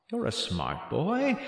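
ordinary delivery

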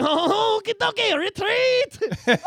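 very high, loud comic retreat cry; mock cowardly France. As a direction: squeal it as a cartoon Frenchman. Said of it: "And France is like".

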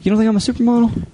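high, fast pleading question; mock needy. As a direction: fish for a compliment. Fishing for a compliment.

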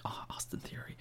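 hushed, breathy aside as if dazed; mock whisper. Bored fan whispering to himself while zoning out of a dull match. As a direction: whisper it like your focus just slipped away.

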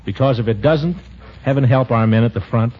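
sincere closing appeal; earnest diary reading. Acted. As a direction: read it like a heartfelt diary.

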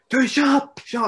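very high, loud shout of shut up; angry heel yell. Retold or imitated. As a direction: scream it at the crowd to silence them. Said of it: "I went".

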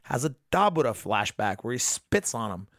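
normal talking voice

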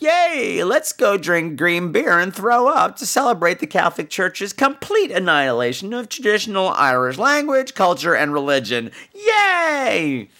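mock high cheer, dripping sarcasm; high sarcastic yay; sarcastic party cheer. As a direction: cheer like a drunk reveler, mocking. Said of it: "it's like".

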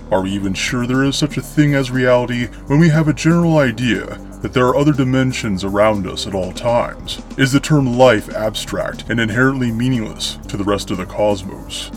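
plain reading voice

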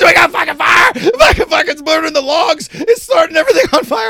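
high, loud panicked shouting; frantic shout from the burning hill; frantic yell. Retold or imitated. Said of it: "He's like".

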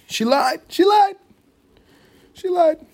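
very high falsetto squeal; cartoonish high-pitched outcry; squealed mock protest. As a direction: squeak it out in short, indignant bursts. Jokey squealed protest after beating story.

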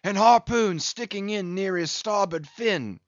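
put-on a rueful old English whaler telling his loss; a hint of a weathered English captain; Englishman captain.

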